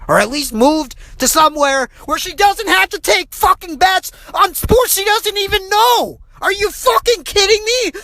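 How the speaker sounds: high-pitched furious rant; incensed rant. Real, not acted. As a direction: vent the outrage, voice up high. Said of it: "I'm incensed".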